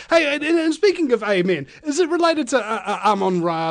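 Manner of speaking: high, laughing through words. Real, not acted. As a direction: keep laughing as you talk. Laughing into joke.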